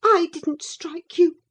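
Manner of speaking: high, loud protest; upset girl. A narrator's reading. Cathy holds back tears before Linton, high upset protest. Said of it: "to prevent another burst of emotion".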